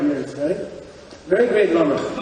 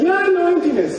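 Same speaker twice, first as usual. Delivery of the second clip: cocky claim; 11 semitones higher than usual. Retold or imitated. Cocky student claims knowledge.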